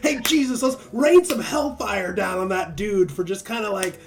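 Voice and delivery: loud, high mock fury; mock zealous plea. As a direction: beg Jesus for vengeance, over the top. Acting John asking for hellfire.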